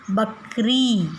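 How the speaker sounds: बकरी stretched very slowly, long final ई; teacher stretching the long vowel; drawn-out word. Shown as a demonstration. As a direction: sound out बकरी slowly for children. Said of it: "बड़ी ई की मात्रा".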